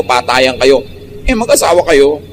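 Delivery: ordinary delivery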